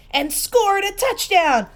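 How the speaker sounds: rising to a loud, high cheer; excited shout. A narrator's reading. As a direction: shout it out like a goal call. Exciting climax of the game.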